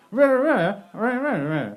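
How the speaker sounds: high, loud babble in her inflection; girlfriend seeking agreement. Retold or imitated. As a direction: mimic her tone without real words. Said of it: "Do you agree?".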